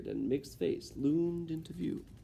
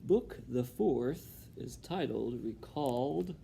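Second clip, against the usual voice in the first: ordinary delivery, nothing marked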